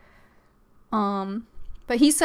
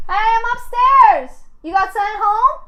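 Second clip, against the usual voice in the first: high, loud call upstairs; yelling across the room; 13 semitones higher, 7 dB louder than usual. Real, not acted. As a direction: call out to someone in another room. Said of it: "I didn't wanna, like, yell".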